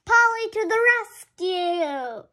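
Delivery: high, loud triumphant call; loud excited rescue call; heroic cry. Acted. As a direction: shout it like a rescue cheer. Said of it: "cries Grandpa Pig".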